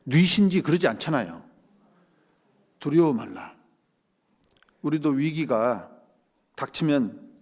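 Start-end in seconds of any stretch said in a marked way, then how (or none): 2.8-3.5 lightly voiced divine reassurance; God's words. Preacher voicing the Lord's answer, calm and reassuring. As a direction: speak "두려워 말라" as the Lord, calmly. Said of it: "주님 뭐라고 그래요".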